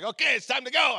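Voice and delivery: high mock announcing call; imitated call-out. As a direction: call out like the jump crew. Jump crew calling out to jumpers.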